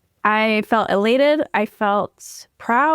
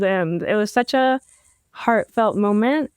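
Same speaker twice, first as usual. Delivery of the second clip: ordinary talk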